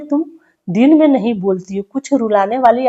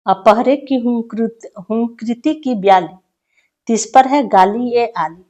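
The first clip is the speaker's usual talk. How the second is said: raised, measured poetic recital; poem recitation; 2 semitones higher, 4 dB louder than usual. Acted. Teacher reading a prisoner's poem aloud to students, declaiming. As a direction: recite each line with weight to the class.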